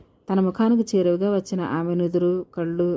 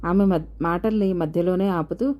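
ordinary delivery, nothing marked

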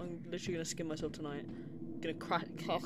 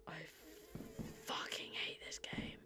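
whisper; 2 semitones lower, 7 dB quieter than usual. Real, not acted. Alone in the dark game, dreading whisper. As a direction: breathe it out in dread.

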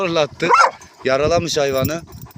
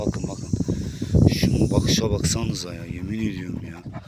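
quiet, low comment to viewers; whispering to viewers about the snake's stare, very quiet; hushed aside; 13 semitones lower, 7 dB quieter than usual. Real, not acted. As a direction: hush it so the snake stays calm.

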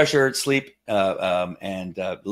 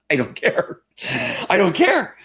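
emphatic dismissal; 9 semitones higher than usual. Real, not acted. Brushing off doubts about the cause.

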